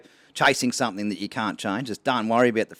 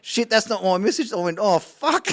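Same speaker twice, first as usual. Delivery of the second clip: high, louder retold dismay, half laughing; horrified realisation; 10 semitones higher, 3 dB louder than usual. Man realising he grabbed the wrong woman, mock horrified. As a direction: re-enact the blunder sinking in, pitched up.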